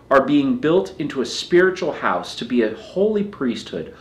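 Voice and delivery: ordinary talk, nothing marked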